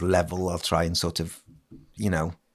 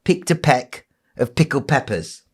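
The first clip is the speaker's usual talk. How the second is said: slow careful tongue twister; 6 semitones higher, slower than usual. Shown as a demonstration. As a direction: spell out each p slowly. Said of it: "can you say".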